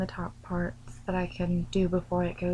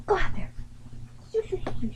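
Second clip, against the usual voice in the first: high-pitched shooing; shooing a pet; 11 semitones higher, much faster than usual. Real, not acted. Owner sending the dog out of the room, high shooing. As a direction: chase the dog out with high little shoos.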